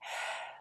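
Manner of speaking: a soft unvoiced sigh of fatigue; weary sigh. Martina sighs at yet another suitor. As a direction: breathe out, tired of suitors. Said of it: "Martina sighed".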